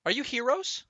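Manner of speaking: put-on high, soft, wondering child voice; small innocent voice, the question hanging in the air; little girl asking. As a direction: ask it like a shy little girl meeting heroes. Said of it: "she asks".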